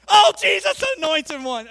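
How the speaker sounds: loud, high-pitched hammed-up outburst; mock exclamation. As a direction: shout it as an overblown mock oath. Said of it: "do they say it like that".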